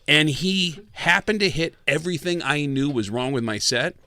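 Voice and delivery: ordinary delivery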